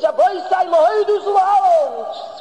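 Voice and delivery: high, loud and drawn-out preachy sing-song; imitating a mussar preacher. Rabbi mimicking a Friday mussar preacher for his students, high and drawn-out. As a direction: ham up the fervent shmooze speaker, stretching every word. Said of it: "a Friday Mussar Shmooze where he says".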